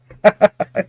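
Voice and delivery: loud high laugh breaking out; a sudden loud self-mocking laugh; burst of laughter. Real, not acted. Sudden laugh at own tangent.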